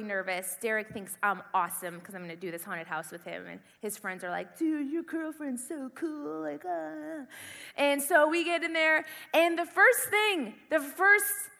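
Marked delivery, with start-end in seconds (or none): 4.6-7.3 drawn-out, soft dude impression; mock admiring dude. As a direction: imitate an impressed guy, stretching the words. Voices admiring friends of boyfriend.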